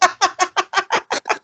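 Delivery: high staccato burst of laughter; laughing. Real, not acted. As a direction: crack up at your own clumsy segue. Cracks up at his own segue.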